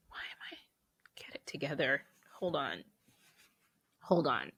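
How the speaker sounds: soft whisper, pulling yourself together through tears; tearful whisper. Real, not acted. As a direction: whisper to yourself to stop crying. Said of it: "on the interwebs crying".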